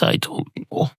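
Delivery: breathless unvoiced whisper; whispered despair. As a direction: breathe it out hopelessly. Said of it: "the barman says".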